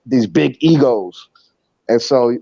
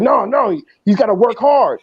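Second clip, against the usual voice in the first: imitating heated coach; 9 semitones higher than usual. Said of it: "he's going crazy".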